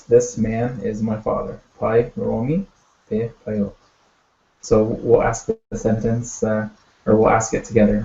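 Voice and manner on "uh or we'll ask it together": ordinary delivery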